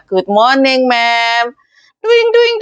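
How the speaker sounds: very high bouncy sing-song; cheerful self-pep. Shown as a demonstration. Maid cheering herself up in the morning, very high and bouncy. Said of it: "untuk menyemangati diri sendiri".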